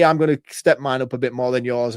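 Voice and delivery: usual speaking voice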